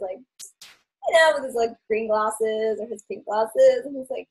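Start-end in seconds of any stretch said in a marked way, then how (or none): 0.2-1.3 slow, drawn-out chilled delivery; imitating someone super chilled, higher pitched; Skull Teddy. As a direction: stretch it out, laid-back. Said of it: "so chilled out and he's just".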